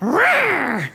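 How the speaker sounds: loud, high mock roar; imitated roar. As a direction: roar like an angry beast, hammed up. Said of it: "It sounded like".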